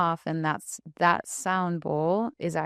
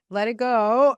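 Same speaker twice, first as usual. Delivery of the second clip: mock shout; 6 semitones higher, 7 dB louder than usual. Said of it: "starts screaming".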